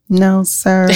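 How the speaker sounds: a hint of a meek little kid answering. Mentor pushes her about the book, lightly voiced meek kid. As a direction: shrink down and answer like a scolded child. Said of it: "I would turn into a little kid".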